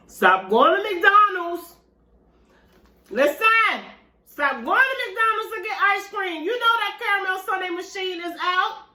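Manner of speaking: high, emphatic pleading; excited rant. Real, not acted. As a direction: beg them to stop, worked up. Urging viewers, excited rant.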